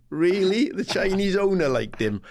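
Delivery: high, incredulous sneer; sarcastic disbelief. Retold or imitated. Manager to the club chief, mocking the owner excuse.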